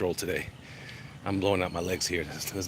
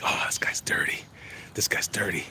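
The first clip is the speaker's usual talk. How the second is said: hushed aside about a traitor; conspiratorial whisper; 4 dB quieter than usual. Real, not acted. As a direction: whisper it like sharing gossip. Reacting to a traitor in trailer.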